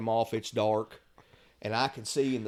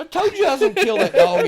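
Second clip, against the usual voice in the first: much higher, louder, laughing boast; fast gleeful laughing brag; triumphant laugh; 11 dB louder, faster than usual. Retold or imitated. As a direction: crow it out through laughter. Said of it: "I said".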